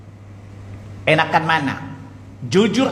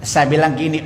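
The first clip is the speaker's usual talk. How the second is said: ordinary talk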